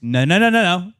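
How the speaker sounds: dad mode. Retold or imitated. Dad correcting a grown man like a child, scolding. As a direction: cut a misbehaving child off. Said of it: "I was just in dad mode".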